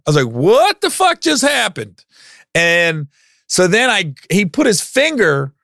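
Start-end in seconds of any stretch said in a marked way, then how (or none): 0.3-2.0 high, drawn-out disbelief; incredulous reaction. Retold or imitated. Guest suddenly yelled at by the freshman, incredulous.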